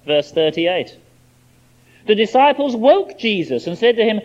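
normal talking voice

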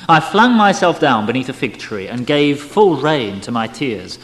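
ordinary delivery, nothing marked

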